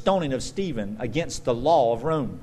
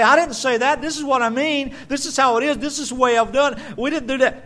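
mock defensive protest; 10 semitones higher, 6 dB louder, faster than usual. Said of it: "He does not say".

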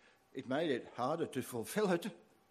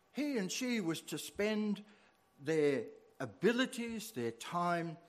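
ordinary delivery

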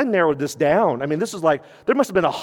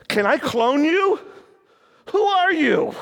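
delighted; 11 semitones higher than usual. Retold or imitated.

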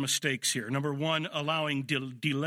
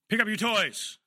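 higher and louder order; a first mock holler; raised voice; 8 semitones higher, 5 dB louder than usual. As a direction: start to holler at the kid. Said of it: "hollering to get compliance".

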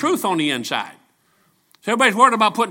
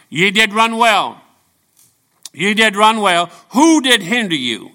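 preaching scripture; 2 semitones higher, 6 dB louder, slower than usual. Acted. Preacher reading the verse aloud.

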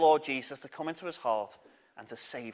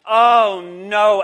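oh no shouted louder; 2 semitones higher, 9 dB louder than usual. Shown as a demonstration. Teacher showing children how to shout, loud. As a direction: belt out the oh no. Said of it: "you have to shout".

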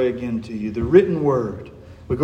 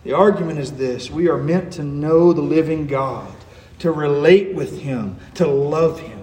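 ordinary talk, nothing marked

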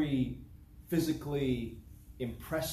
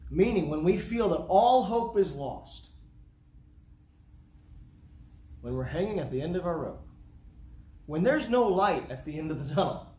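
preaching at full force; 3 semitones higher, 5 dB louder than usual. Acted. Preaching peak.

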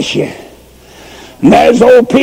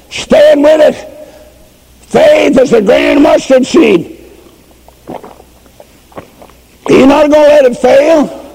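high, ringing sermon cry; fervent preacher lifting the church; preaching at full force; 6 semitones higher than usual. Acted. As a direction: preach it out to the congregation. Preacher builds to a climax.

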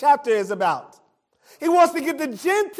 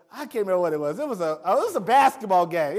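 usual speaking voice

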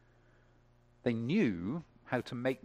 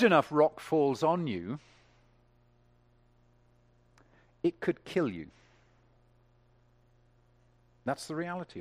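much higher and louder, ringing out marveled; full-force preaching; 6 semitones higher, 9 dB louder than usual. Acted. Preacher exulting to the congregation, full-force. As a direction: preach it out to the rafters.

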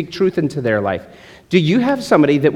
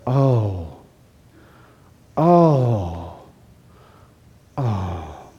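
lower, soft empathetic sighs; sympathetic oh; 6 semitones lower, 4 dB quieter, much slower than usual. Retold or imitated. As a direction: coo in mock sympathy like a talk host. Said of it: "sat on a couch and said".

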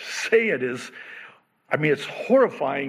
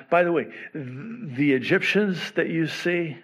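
normal talking voice

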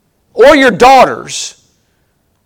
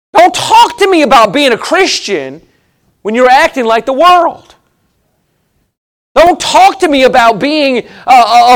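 preaching hard, higher and pressing; preaching rebuke; 3 semitones higher than usual. Real, not acted. Preacher rebuking his congregation, raised and forceful. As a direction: call out the hypocrites.

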